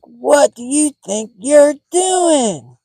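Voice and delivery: very high, loud, slow outraged mock scream; screaming lady impression. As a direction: holler it like a furious neighbor, each word stretched. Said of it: "And she's screaming".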